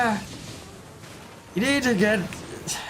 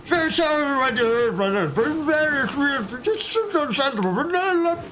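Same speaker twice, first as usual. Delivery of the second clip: put-on very drunk countryside Scottish, pitched much higher; drunken Scottish accent; 12 semitones higher than usual. Shown as a demonstration. Streamer showing chat a very drunk Scottish accent. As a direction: slur it like a Scotsman too far gone. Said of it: "in the proper countryside Scottish".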